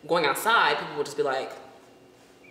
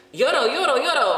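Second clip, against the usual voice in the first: louder, higher mock chant of euro; hammed-up insistent demand; imitating the attendant; 9 semitones higher, 7 dB louder than usual. The beach guy demanding euros, loud mock chant.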